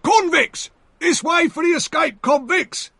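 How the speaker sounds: high, loud calling shout. Acted. The other convict calls the soldiers, loud high shout. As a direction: bellow it across the marshes to the soldiers. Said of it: "shouted the other".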